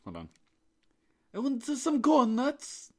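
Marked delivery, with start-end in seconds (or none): 1.3-2.9 very high, loud, slow bad Irish accent; badly faked Irish squeal; attempted Irish accent. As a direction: have a failed go at Irish, way up high. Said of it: "Try to do Irish".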